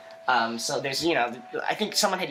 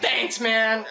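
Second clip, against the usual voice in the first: higher, laughing mock gratitude; sarcastic thanks; 8 semitones higher than usual. To the guy leaving the bathroom, sarcastic laughing thanks. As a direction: thank him dryly, amused.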